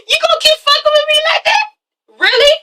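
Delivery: very high, indignant challenge; acted angry confrontation. Acting German confronting Asha.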